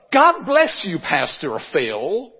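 imitating a deep South American drawl, beaming; a hammed-up deep South preacher greeting, God bless you; deep South accent. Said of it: "in a deep, deep South accent".

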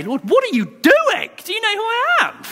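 high mock indignant whine; hammed-up offended voice, very high; indignant complaint to God. Preacher mimicking his own complaint to God, high whine. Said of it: "and then say".

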